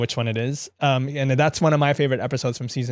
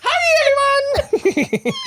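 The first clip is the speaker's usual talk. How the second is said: very high, slow, laughing screech; witch voice; much slower than usual. Pretend. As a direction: cackle it out like a wicked witch. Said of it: "You sound like the Wicked Witch of the West".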